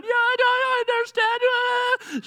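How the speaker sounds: put-on high, slow baby voice; mock baby voice. Parent mimicking daughter Nola in a high baby voice. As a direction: squeak it out like a fake-agreeable toddler. Parents voice baby's fake compliance.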